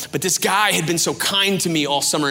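normal talking voice

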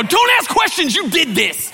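high, loud mock yell; hammed-up shrieked blame; frantic scolding. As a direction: yell it at little brother in a panic. Acted yelling at brother.

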